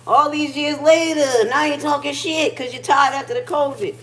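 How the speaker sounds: high, loud, scornful retort; mock outrage at whiny nurses; indignant rant. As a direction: throw it back at them, fed up. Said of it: "and I'm like".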